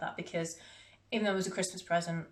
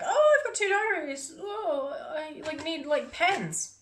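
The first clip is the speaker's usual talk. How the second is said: much higher, louder, gushing over new stationery; excited self quote; 9 semitones higher, 4 dB louder, slower than usual. Retold or imitated. Vlogger mimics her own excitement about needing pens, giddy and high. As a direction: squeal it like a giddy stationery addict. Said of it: "because I'm like".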